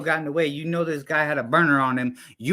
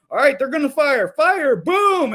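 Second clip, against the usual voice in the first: louder, higher barked battlefield order; mock soldier command; 7 semitones higher, 5 dB louder than usual. Mocking old-time line battles, mock officer shouting fire. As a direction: ham up a redcoat officer yelling fire.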